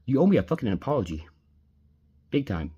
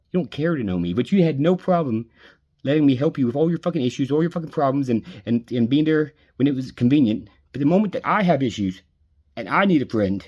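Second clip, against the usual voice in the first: higher and louder, pressing every line; angry accusation; 4 semitones higher, 3 dB louder than usual. Real, not acted. Telling best friend she only used him, angry and louder. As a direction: hurl the blame at her.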